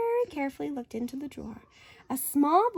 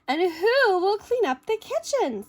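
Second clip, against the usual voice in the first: Shelley; 6 semitones higher, 6 dB louder than usual. Acted. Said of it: "said Shelley".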